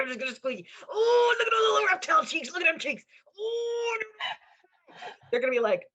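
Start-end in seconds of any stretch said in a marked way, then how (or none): 0.0-4.0 very high, loud cooing squeal; baby-talk squeal. Pretend. Doting on a spirit's reptile cheeks, high squealing coo. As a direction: coo over it like a cute baby. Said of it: "approach them with love and go".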